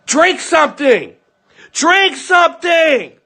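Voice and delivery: high-pitched mock shout, stretching out; imitated yelling. Retelling a mother yelling at her choking son, imitated yelling. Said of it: "she's yelling at him".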